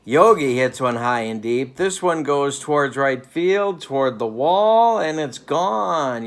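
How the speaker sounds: big play-by-play climax, stretched at the end; excited home-run call. Pretend. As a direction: call the homer as it sails out, voice rising. Calling a home run live.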